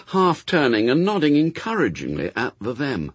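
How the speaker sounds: ordinary delivery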